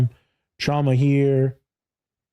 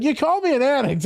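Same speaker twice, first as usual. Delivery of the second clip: much higher mock offended father; indignant dad; 13 semitones higher than usual. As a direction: voice the father's complaint, high. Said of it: "He's like".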